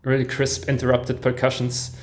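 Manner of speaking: ordinary talk, nothing marked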